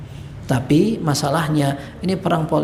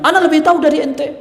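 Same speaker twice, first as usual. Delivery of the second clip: much higher, louder haughty snap; arrogant retort; 10 semitones higher, 5 dB louder, faster than usual. Retold or imitated. Governor rejecting advice, loud and arrogant. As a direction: put the adviser in his place. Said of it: "ini tadi bilang".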